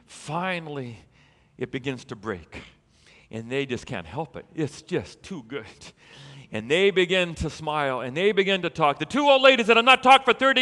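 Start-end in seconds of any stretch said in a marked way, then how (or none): none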